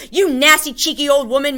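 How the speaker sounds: loud, high angry shout. A narrator's reading. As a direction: shout the insult at the old woman. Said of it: "Mister Bruno Jenkins shouted".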